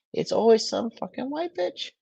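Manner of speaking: high, mocking put-on voice.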